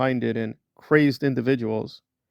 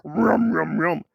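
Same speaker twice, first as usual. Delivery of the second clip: mock munching; 4 semitones higher, much slower than usual. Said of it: "And then".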